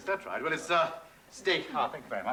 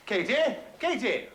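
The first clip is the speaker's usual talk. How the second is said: louder and higher, calling across the house; 10 semitones higher, 4 dB louder than usual. Acted. Husband calling his wife to see the guests, calling out. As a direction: summon her from the next room.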